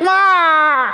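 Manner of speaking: high, loud tantrum cry; imitated child's wail. Son upset at grandma's early greeting, imitated loud wail. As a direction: wail like a thwarted kid. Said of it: "And he's like".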